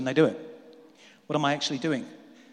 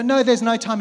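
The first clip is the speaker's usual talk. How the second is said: higher, louder hurried no; exasperated protest; 6 semitones higher, 7 dB louder than usual. Retold or imitated. Believer protesting to God, exasperated. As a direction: brush it off as too busy. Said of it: "You go".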